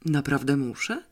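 ordinary delivery

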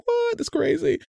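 high, stunned disbelief; shocked. Retold or imitated. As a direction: squeak out the surprise. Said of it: "I was like".

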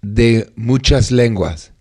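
slow careful Spanish; Spanish accent. Shown as a demonstration. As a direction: spell it out slowly in Spanish. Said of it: "Spanish drill".

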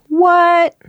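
high, loud surprised yelp. Real, not acted. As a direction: squeak it out in disbelief. Reacts to a second listener Sarah.

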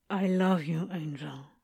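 fading to a soft hush on angel; muffled reply. A narrator's reading. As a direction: say it into his chest, trailing off. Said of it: "Crowley giggled and hid his face".

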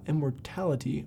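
normal talking voice

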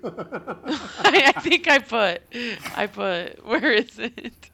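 giggly, slow searching question; laughing while searching. Real, not acted.

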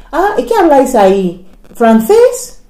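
high, incredulous questioning; mock surprise. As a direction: imitate someone amazed and a little clueless. People asking about her language.

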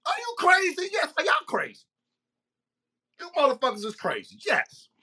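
high-pitched, exasperated disbelief; incredulous outburst. Real, not acted. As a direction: voice jumps up in real outrage.

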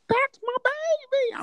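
very high, drawn-out proud mom squeal; high-pitched mock cheer for her child. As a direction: imitate Mom hollering from the stands. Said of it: "my mom is like".

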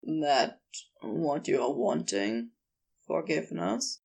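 low, tender question with gentle pauses; low character voice, tender and hesitant. A narrator's reading. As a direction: ask gently, dropping your voice. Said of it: "he said tenderly".

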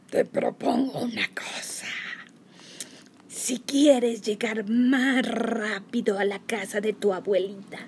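hushed, sly, slow scheming wolf; sly wolf whisper. Acted. Wolf tempts the girl.

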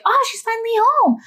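high, fussing family voices; mock-worried family chorus, pitched well up. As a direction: squeal it like the worried kids. Family greeting drunk mom.

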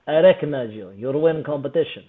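thick mock Russian accent. Russian stranger walks over to him at the gym, mock Russian accent. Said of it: "that is a pristine Russian accent".

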